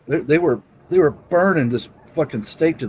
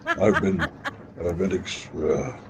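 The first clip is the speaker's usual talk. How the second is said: demon voice; 7 semitones lower, 7 dB quieter than usual. Pretend. Voice changer demon roleplay.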